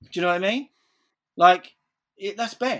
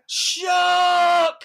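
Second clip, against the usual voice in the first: very high, drawn-out mock yell; hammed-up cry of alarm; imitated alarm shout; 5 dB louder than usual. Kid at the plane window seeing a shark, imitated alarm shout.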